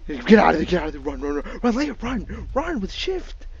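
loud, rising urgent yelling; being chased. Real, not acted. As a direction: scream at a friend to flee.

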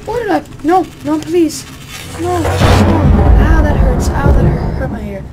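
high, loud cries of hurt; yelling in pain. Real, not acted. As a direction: yell as if the noise stings. Sudden loud game noise.

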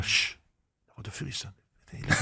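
quiet, dismissive shushing of a silly question; retelling the chef's hushed brush-off; hushed scornful chef. Retold or imitated. Chef retold dismissing freezing.

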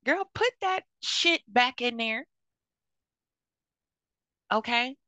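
high, loud stern order; stern loud scolding. Acted. Auntie ordering a young mom to put stolen makeup back, loud and stern. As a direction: demand it back right now. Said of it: "and be like".

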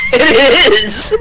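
loud laughing shout. Real, not acted. Laughing shout after name.

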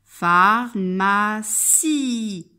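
"Pharmacie" very slow, three stretched syllables joined up. Shown as a demonstration. Teacher checking for the sound I, "Pharmacie" slowly stretched. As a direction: draw out "Pharmacie", holding the last I. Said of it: "J'entends le son I".

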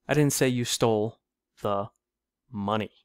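"money" held back by pauses; stress on MONEY. Shown as a demonstration. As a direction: pause before "money" to set it apart. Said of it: "with emphasis on each word".

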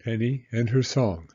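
ordinary delivery, nothing marked

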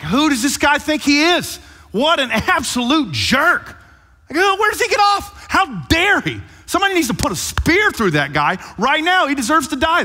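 high, loud outraged rant; angry yelling. Acted. Preacher acting out David's reaction to Nathan's story, angry yelling. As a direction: yell it in full-blown indignation. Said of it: "David is yelling and cussing".

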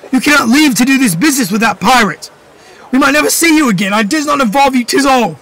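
high, urgent plea; boy's high urgent plea; pleading. Acted. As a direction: beg him not to go. Boy pleads with father.

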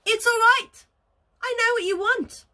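high, bright goose voice; lightly voiced goose. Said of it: "said Lucy".

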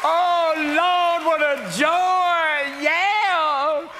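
high, drawn-out revival-style shout; huge high celebratory holler; mock exultation. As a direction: cry out slowly in over-the-top joy. Mock exultation over America advancing.

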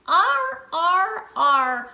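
"R" called out high and loud, falling; loud repeated R. Shown as a demonstration. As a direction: cheer the sound out. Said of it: "A R says R".

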